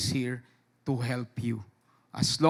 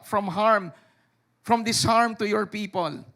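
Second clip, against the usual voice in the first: scripture reading; 6 semitones higher, 5 dB louder than usual. Acted. Preacher reads Exodus to the church, loud and solemn. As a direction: proclaim the verses to the congregation.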